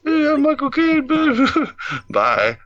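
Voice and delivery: high-pitched, laughing celebrity impression; squeaky mock Cockney actor, breaking into laughter; bad Michael Caine. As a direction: do a poor high-voiced British actor. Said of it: "here's my Michael Caine impression. It's not as good as Kevin's".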